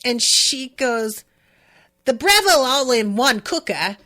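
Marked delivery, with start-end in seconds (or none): 2.0-4.0 imitating a broad Aussie product presenter, a bit louder; mock Australian ad voice; broad Australian accent. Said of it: "like, really broad Australian accent".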